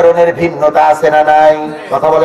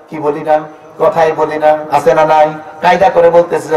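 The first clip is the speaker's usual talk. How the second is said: ordinary talk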